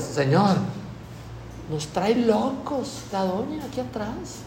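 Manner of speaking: queja suave y lenta, a media voz; discípulos quejándose. Retold or imitated. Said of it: "surgieron con una creatividad". (queja lenta en voz baja)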